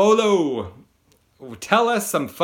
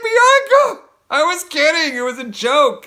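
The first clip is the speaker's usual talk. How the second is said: much higher and louder, laughing it out; laughing reassurance; 10 dB louder, slower than usual. Retold or imitated. Teacher reassuring the crying girl, laughing high. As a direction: squeal it through laughter, half panicked. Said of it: "I was like".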